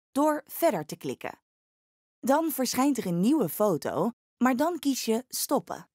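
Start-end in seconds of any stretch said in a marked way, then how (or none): none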